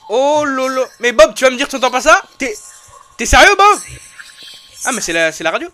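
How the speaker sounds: high, loud, fast panicked pleading; freaking out at a noise; scared horror game streamer. Real, not acted. Panicked at strange sound.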